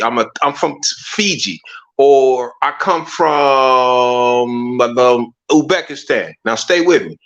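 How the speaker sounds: ordinary talk, nothing marked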